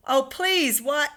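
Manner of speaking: called out. A narrator's reading. Miss Spider calling down to the people below for help. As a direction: plead loudly for rescue. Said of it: "Miss Spider called out".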